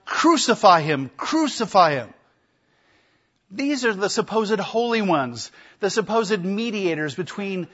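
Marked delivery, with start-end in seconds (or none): 0.0-2.1 loud, mock mob cry; crowd shouting. Priests crying out to Pilate, loud mock shout. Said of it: "they cried out".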